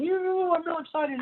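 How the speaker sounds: very high, hyped-up yoo; hyped cone. Acted. Lecturer voicing the red cone, high and hyped. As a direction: whoop it like a pumped-up bro.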